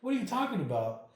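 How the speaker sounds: high, loud, half-laughing disbelief; agitated retelling with a laugh in it; bewildered protest. Retold or imitated. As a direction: reenact a baffled demand for answers. Acted protest, laughter around it.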